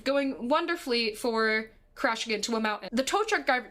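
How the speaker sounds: usual speaking voice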